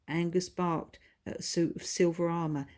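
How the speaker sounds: ordinary delivery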